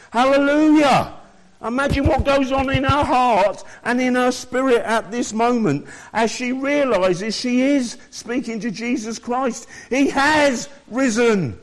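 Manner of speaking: high, loud jubilant praise; rejoicing preaching. Real, not acted. Preacher exulting to the congregation over the risen Christ, high and loud. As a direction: lift them with joyful praise.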